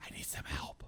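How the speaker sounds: barely audible whisper; mousy whisper. Retold or imitated. Husband imitating wife calling from the closet, mousy whisper. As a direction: whisper it far too softly to be heard. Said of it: "my wife talks like a mouse".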